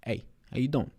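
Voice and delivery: ordinary talk, nothing marked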